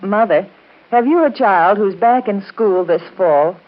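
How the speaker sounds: usual speaking voice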